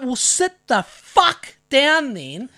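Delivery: slow, drawn-out angry order; real mad, retold. Man ordering the kid he grabbed to sit, slow retold fury. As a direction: spell out the command word by word in retold anger. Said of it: "Got real mad".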